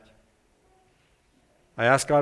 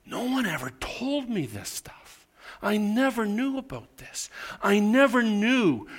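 amazed discovery; 7 semitones higher than usual. Retold or imitated. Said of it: "It was like".

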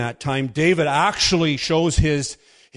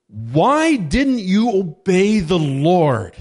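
slow, louder, higher furious question; ballistic rebuke; 6 semitones higher, 6 dB louder, much slower than usual. Retold or imitated. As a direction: drag out the rebuke in anger. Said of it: "he goes ballistic on him".

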